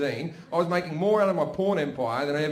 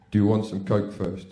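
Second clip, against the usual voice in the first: deep man's voice; 10 semitones lower than usual. Retold or imitated. The big man offering coke first, deep voice.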